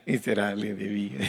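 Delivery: can't keep a straight face; laughing. Acted. Congregant reads the lament aloud, laughing through it. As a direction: lament read through a giggle. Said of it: "Así con cariño".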